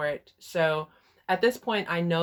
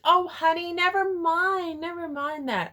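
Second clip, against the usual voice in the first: syrupy dismissive mother; 14 semitones higher, 7 dB louder than usual. Retold or imitated. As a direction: wave it away in a fake-sweet coo. Mother brushing off question again.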